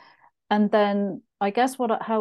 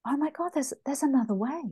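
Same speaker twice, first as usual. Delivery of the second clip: higher-pitched retold amazement; amazed realisation; 6 semitones higher, 3 dB quieter, faster than usual. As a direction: lightly re-enact being blown away, pitch lifted. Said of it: "absolutely blown away".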